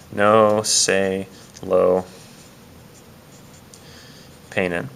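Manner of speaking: drawn out word by word; slow sounded-out command. Shown as a demonstration. Teacher slowly building the Spanish command. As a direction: spell it out slowly for students. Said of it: "teacher sounds out the command".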